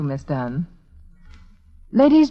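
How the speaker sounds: ordinary talk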